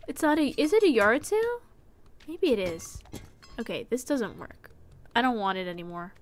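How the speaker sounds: ordinary talk, nothing marked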